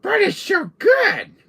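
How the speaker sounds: high, loud, drawn-out protest; slow squealing little villain; Salazar voice. Acted. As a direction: whine back in a silly high voice. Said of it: "That's why your voice sounds funny".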